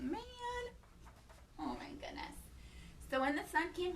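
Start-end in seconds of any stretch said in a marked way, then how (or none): none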